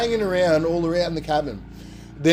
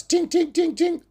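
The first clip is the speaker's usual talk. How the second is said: high, light tapping sound on glass; mock high tinkling taps; tapping noise.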